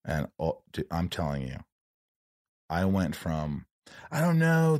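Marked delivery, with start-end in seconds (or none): 4.1-4.8 mock outburst. Retelling his sudden outburst.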